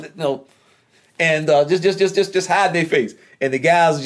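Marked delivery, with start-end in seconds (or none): none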